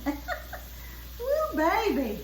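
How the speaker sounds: high, squealing delight over the food; excited admiring. Real, not acted. Cook gushing to viewers over her pretty fish, excitedly. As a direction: gush over how pretty it looks.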